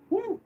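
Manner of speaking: high mock whoop; a long ironic woo; sarcastic woo. Real, not acted. Reacting to dad's claim.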